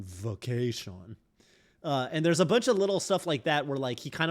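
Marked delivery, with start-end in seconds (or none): 0.0-1.2 VACATION drawn out slowly, softer; quiet, slow mimicry of "vacation"; imitated speech tic. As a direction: stretch "vacation" with a marked V. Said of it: "imitating, um, Reno and Elena's".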